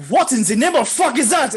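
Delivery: high, loud shock as the wizard; startled outburst. Acted. Wizard spotting a huge giant bat, startled yell. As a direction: break off into a hammed-up alarmed yell.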